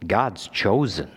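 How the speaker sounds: ordinary talk, nothing marked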